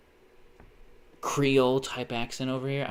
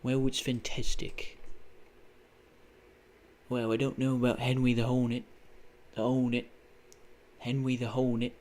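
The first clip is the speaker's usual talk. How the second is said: Roy Hodgson. Retold or imitated. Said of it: "I think of fucking Roy Hodgson".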